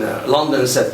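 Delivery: ordinary delivery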